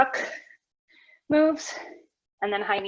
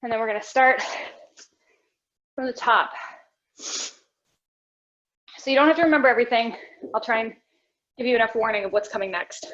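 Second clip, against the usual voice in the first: breathless from the workout; talking between heavy breaths; 2 semitones lower than usual. Real, not acted. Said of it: "If I sound more out of breath than you do".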